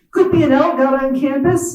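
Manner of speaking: mild imitation of a West Virginia Appalachian accent; a hint of Appalachian twang, tossed off; Appalachian drawl. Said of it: "in his Appalachian drawl".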